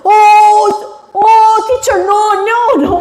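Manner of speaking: high-pitched, playful alarm; imitated panicked protest. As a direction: act out frantic students stopping the teacher. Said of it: "막 이러면서 막 막아요".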